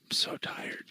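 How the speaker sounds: breathy whispered aside; whisper, worn out. Real, not acted. Whispering on the live call.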